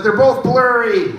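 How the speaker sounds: high, drawn-out complaint; mock-helpless high reply; acted exasperated reply. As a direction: pitch it up and stretch the words. Acts his reply to optometrist.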